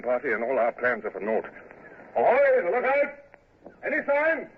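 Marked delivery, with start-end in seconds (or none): 2.2-3.1 shouted hail up the mast; calling out. Acted. Calling up to the masthead. 3.8-4.5 calling out. Acted. Hailing the lookout aloft.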